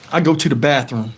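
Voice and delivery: ordinary delivery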